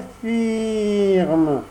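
high odd echoing cat voice. Acted. Cat echoes the fox in a high whine. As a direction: parrot the fox's last word. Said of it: "répéta le chat".

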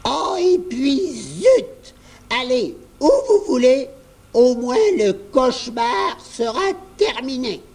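put-on high old voice giving up with a sigh; exasperated grandmother. A narrator's reading. Grand-mère gives in to the children, high weary old voice. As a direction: throw up your hands, worn out. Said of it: "Grand-mère soupira".

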